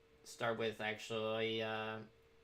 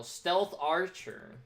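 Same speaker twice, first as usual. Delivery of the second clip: slow, stretched, higher and louder, self-mocking; drawn-out stealth archer; 6 semitones higher, 5 dB louder, much slower than usual. Real, not acted. Player picking the cliché build, drawn out and mocking. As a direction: drag it out like the cliché it is.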